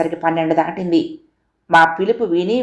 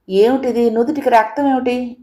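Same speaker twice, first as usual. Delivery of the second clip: higher, startled, demanding to know; alarmed question; 6 semitones higher than usual. Acted. Narrator to Kamala on seeing her bleeding forehead, alarmed and higher. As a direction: ask it in shock at the sight of blood.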